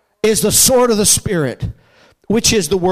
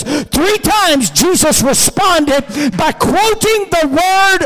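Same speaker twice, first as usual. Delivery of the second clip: raised, driving preacher's cadence; full-force preaching; 6 semitones higher, slower than usual. Real, not acted. Preacher recounts Jesus answering the devil three times, full-force preaching. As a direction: stretch key words, building to a slow ringing climax.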